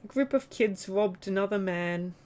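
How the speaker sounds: lightly mocking Australian news voice; parodying a dull news report; mock newsreader. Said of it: "Whereas Australia's like".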